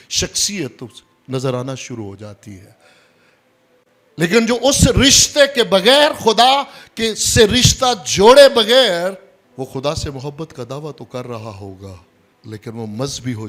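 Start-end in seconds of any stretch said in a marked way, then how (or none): 4.2-9.4 loud, high-pitched pulpit delivery; full-force preaching. Real, not acted. Preacher warns about love without relationship, loud and forceful. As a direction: hammer the point home from the pulpit.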